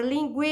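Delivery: "linguiça" with a very long "gui"; stress on penultimate syllable. Shown as a demonstration. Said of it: "a sílaba tônica é a penúltima". ("linguiça" alongando bastante o "gui")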